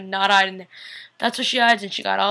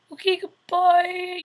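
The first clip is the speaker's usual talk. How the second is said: mock dismayed farewell; 10 semitones higher, much slower than usual. Mock reaction to Tyler leaving.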